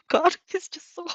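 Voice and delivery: high, laughing, gushing; laughing aside. Real, not acted. Reader gushing about Shinzo while laughing. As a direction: break out laughing over him.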